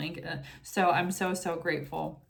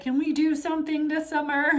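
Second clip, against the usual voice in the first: put-on higher whiny child voice, pleading; imitating her child; 8 semitones higher, 3 dB louder than usual. Mother imitating her child's begging, higher mock-whiny plea. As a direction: play the kid begging for summer fun. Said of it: "my child is just like".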